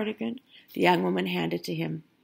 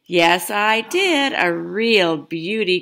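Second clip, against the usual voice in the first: put-on slow, louder, savoring each word like a proud old man; proud Grandpa; 6 dB louder, much slower than usual. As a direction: Grandpa shows off his purchase to Grandma. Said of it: "Grandpa replied".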